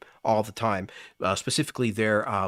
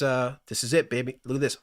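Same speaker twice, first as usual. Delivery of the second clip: usual speaking voice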